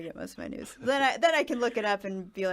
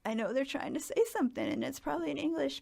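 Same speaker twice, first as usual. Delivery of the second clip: confused tourists; 7 semitones higher, much faster than usual. Retold or imitated. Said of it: "and we're like".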